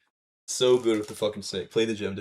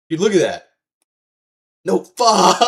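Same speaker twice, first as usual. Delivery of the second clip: hyped gamer bro; 7 semitones higher, 9 dB louder than usual. Real, not acted. Dying in game, laughing.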